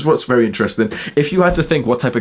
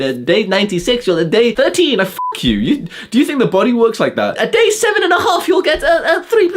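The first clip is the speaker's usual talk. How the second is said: squeaky, much higher voice with laughter breaking through; mock nofap YouTuber; 11 semitones higher than usual. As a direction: ham up a ridiculous guru squealing. Mocking nofap timeline claims.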